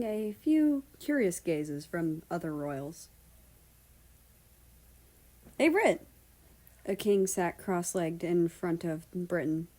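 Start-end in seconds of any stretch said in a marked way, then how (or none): 5.6-6.1 loud, high greeting; calling out. Acted. Dutch hails Britain from afar, loud. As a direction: call across the room.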